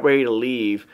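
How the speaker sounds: normal talking voice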